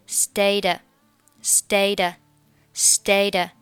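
"stayed" linked to "at" as "stay-da"; slow teaching demo, "stayed" running into a short a. Shown as a demonstration. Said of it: "这里stayed和at连读是". (stayed和at慢慢连读)

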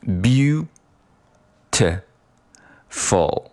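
beautiful broken into slow separate syllables, beau, ti, ful. Shown as a demonstration. Teacher splits the word for students, syllable by syllable. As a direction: sound out each syllable with a gap. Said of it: "这三个音节分别是".

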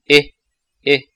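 "诶" said as a clear English E sound; sound demo. Shown as a demonstration. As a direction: isolate the 诶 vowel for the learner. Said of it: "那个E字母发的是". (单独示范"诶"的发音)

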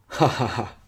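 acted ha-ha-ha laugh; narrator's voiced chuckle for a character; hearty laugh. A narrator's reading. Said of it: "项少龙大笑移前".

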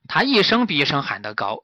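ordinary delivery, nothing marked

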